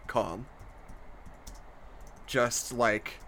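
slow, low and soft epic narration; epic fantasy narrator. A narrator's reading. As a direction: tell it like a solemn fantasy saga. Epic narration of the story ending.

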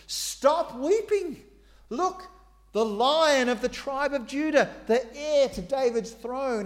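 high, loud triumphant announcement; elder's proclamation. Acted. Elder telling weeping people to stop, high and loud. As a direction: proclaim the victory of the lion. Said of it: "one of the twenty-four elders said to me".

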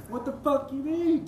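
a mock furious yell at her; intense retold rant. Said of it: "I was intense".

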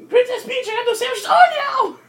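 high, loud squeaky cartoon servant; shrieky falsetto building to a cry of alarm; clumsy Toad. Toad bringing Princess Peach sandwiches, high squeal turning to panic.

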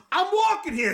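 high, full-on New York holler, imitated; Dustin Hoffman's New York yell. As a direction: re-enact yelling at a cabbie. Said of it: "yelling at the cabbie".

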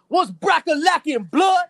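high loud mock gangster greeting; imitating the passenger. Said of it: "He goes".

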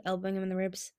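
usual speaking voice

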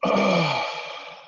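drowsy groaning sigh; mock sleepy sigh. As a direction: sigh like you just woke up. Pretending to wake from nap.

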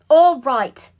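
very loud, high interruption; cutting in firmly. A narrator's reading. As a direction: shout over a quarrel to end it. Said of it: "putting a stop to things".

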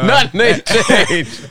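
drawn-out, very slow, laughing repeat. Real, not acted. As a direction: savour the punchline through laughter.

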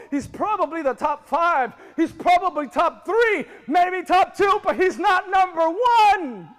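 rising preacher shout; building shout. Acted. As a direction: build it up and land it hard.

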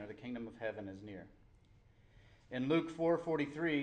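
loud, projected Bible reading; emphatic preacher reading aloud; scripture reading. Real, not acted. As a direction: proclaim the verses firmly to a congregation. Reads scripture to the congregation.

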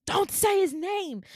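high, loud cry of protest; retold outburst. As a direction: snap it out high and loud, imitating her. Acted outburst of upset woman.

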